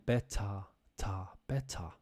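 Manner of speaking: "better" broken down to a soft "ta" ending, no r; R left off. Shown as a demonstration. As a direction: quietly show "better" as "bet-tah". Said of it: "I'm not saying the R at the end".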